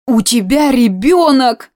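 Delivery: put-on amazed old aunt; astonished. A narrator's reading. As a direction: gasp it out, hands thrown up. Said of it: "всплеснула руками Алевтина".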